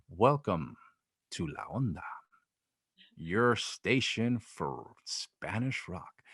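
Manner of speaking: slow, low, lifeless radio announcer voice; lightly mocking a dull announcer, dragged out and low; boring announcer. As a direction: drone it like a dull DJ with zero energy. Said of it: "he was really boring".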